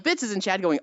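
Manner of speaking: normal talking voice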